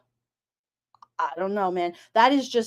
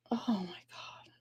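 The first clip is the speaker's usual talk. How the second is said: whisper; 4 semitones lower, 16 dB quieter than usual. Real, not acted. Stunned aside during crime story.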